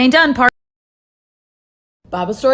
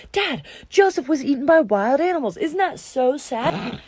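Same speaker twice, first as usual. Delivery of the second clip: fake sad; 3 semitones higher, slower than usual. Brothers fake grief to father.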